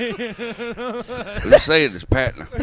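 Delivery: laughing. Real, not acted. Laughing insistence.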